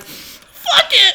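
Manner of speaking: high, loud laughing shout; loud release. Retold or imitated. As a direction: let it all out laughing. Following doctor's advice.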